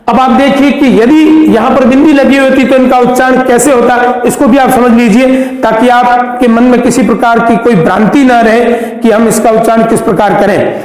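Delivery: usual speaking voice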